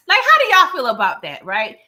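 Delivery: teasing the audience with glee; amused outburst. Real, not acted. Host asking viewers about Ghost's hypocrisy, amused outburst.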